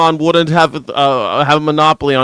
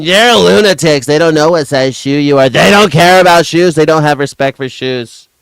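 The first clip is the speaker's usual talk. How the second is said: mock Trump; 3 dB louder than usual. Continuing Trump impression.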